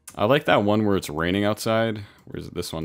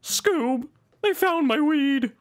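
much higher, slow stoner cartoon voice; Shaggy; much slower than usual. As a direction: panic like a hippie cartoon teen. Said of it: "Maybe we go for a Shaggy".

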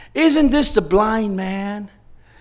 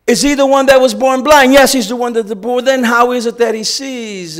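interrogating Pharisee; 6 semitones higher, 7 dB louder than usual. Retold or imitated. Pharisees question the parents.

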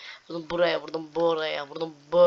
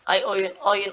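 normal talking voice

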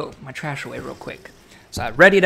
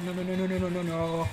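usual speaking voice

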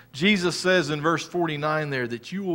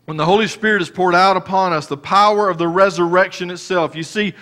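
raised, loud preaching voice; full-force sermon delivery; fervent preaching; 5 semitones higher, 5 dB louder than usual. Acted. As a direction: preach it out to the congregation. Preaching rises again.

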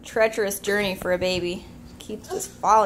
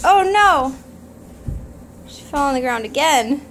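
loud, high-pitched alarm; shocked outburst; 5 semitones higher, 8 dB louder than usual. Real, not acted. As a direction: cry out in shock. Baby falls, shocked outburst.

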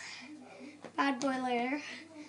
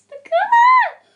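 excited squeal; 15 dB louder than usual. Real, not acted. Seeing the colour she ordered, excited squeal.

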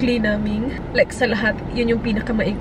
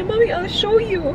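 put-on higher, slower little-girl apology; imitating her daughter; 8 semitones higher than usual. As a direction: lightly voice a small girl saying sorry. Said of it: "Sabi niya".

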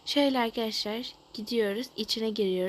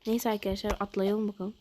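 usual speaking voice

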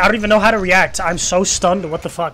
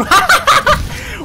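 high laugh. Real, not acted. Laughs after surprise kill.

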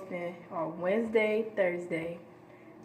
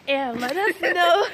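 loud, slow, laughing through words; giggling while saying it; 4 semitones higher, 3 dB louder, slower than usual. Real, not acted. Breaks up laughing mid outro.

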